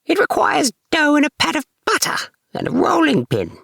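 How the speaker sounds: put-on very high, squeaky old rat woman; fussy rat wife listing what she needs; Anna Maria. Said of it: "said Anna Maria".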